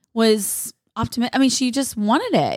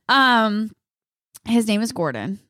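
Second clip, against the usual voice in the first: usual speaking voice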